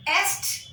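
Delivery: "est" with the s and t sounded; mispronounced est. Shown as a demonstration. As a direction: pronounce every consonant in "est". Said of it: "It is not".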